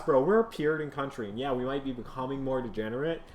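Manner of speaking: usual speaking voice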